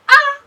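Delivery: loud, high "ah!"; shocked cry. Acted. Daughter seeing the phone screen, loud high shocked yelp. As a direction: yelp at the sudden sight. Shocked at what dad shows her.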